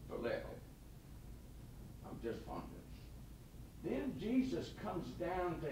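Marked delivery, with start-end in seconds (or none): 0.1-3.9 slow, soft, trailing admission; sheepish whisper. Acted. Peter admitting to Jesus he is only fond, whispered. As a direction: admit it quietly, almost ashamed.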